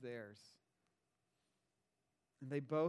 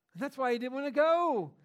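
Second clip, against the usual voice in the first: much higher and louder punch; emphatic; 7 semitones higher, 8 dB louder than usual. Real, not acted. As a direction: drive the point home to the congregation. Preacher's emphatic point.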